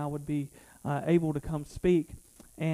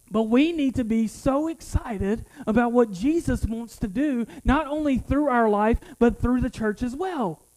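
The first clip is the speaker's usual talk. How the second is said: loud, higher fervent preaching; 5 semitones higher, 5 dB louder than usual. Acted. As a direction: preach to the congregation with full fire. Sermon climax.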